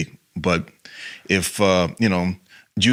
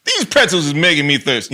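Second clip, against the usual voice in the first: higher, louder, in a put-on voice; silly voice; 9 semitones higher, 6 dB louder than usual. Shown as a demonstration. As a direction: go big and goofy. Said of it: "it could be anything".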